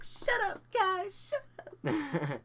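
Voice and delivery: high, loud squealed protest; whine-shout at teasing friends, hammed up; flustered falsetto outburst; embarrassed mock yell. Embarrassed retort to teasing, acted out.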